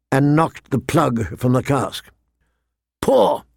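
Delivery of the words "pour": high, abrupt one-word order; commanding. A narrator's reading. Knight ordering the seller to fill cups, commanding. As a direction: give the order with no room to argue. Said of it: "Ser Jorah commanded".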